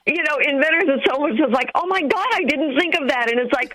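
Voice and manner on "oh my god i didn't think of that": high-pitched mock alarm; panicked inventor. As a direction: imitate an inventor suddenly panicking. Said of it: "inventor's panic attack".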